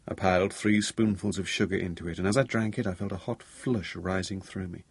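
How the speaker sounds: usual speaking voice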